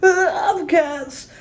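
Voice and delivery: high playful croon; high playful British croon. Shown as a demonstration. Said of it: "it's playful".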